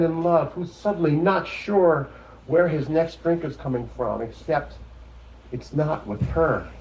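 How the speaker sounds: lifted, measured poem recital; poetry reading. Acted. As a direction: read it out as a poem, leaning on the stressed words.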